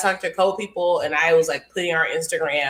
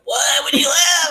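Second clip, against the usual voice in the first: much higher and louder, a shocked cry; shocked shout; 10 semitones higher, 4 dB louder, slower than usual. Retold or imitated. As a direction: yelp it out like the news hit hard. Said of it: "I was like".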